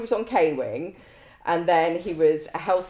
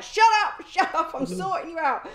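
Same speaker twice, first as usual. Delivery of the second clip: mock snapping back; 9 semitones higher than usual. Officer snaps at the complaining prisoner while bandaging. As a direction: brush him off, laughing but firm. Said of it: "And I'm like".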